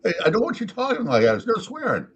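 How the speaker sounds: a hint of an exasperated pet owner; scolding owner. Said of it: "He's like".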